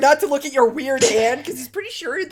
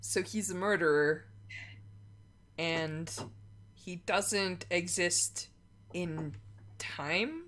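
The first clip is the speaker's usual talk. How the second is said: stunned quiet; 5 semitones lower, 10 dB quieter, slower than usual. Real, not acted. Stunned player processing news.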